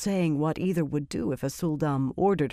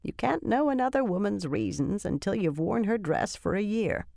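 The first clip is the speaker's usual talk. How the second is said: lightly voiced remembered advice, pitched higher; a higher character voice quoting old advice; Linny; 5 semitones higher than usual. A narrator's reading. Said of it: "She heard Linny's voice again".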